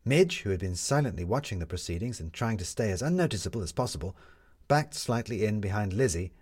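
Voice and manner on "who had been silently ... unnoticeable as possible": ordinary delivery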